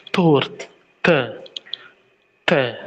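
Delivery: teacher's T demo; Russian T. Shown as a demonstration. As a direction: say th, th, then tort with a crisp Russian T. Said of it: "The sounding is really important".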